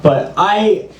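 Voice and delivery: usual speaking voice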